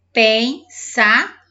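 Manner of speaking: "Pensador" drawn out with gaps between syllables. Shown as a demonstration. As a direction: punch each syllable of "Pensador". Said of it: "tem que se manter na sílaba dele".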